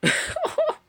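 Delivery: sudden high burst of laughter; laughing. Real, not acted. Gamer caught laughing while watching YouTube, real laughing. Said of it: "je rigole parce que".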